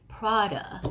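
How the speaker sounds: ordinary delivery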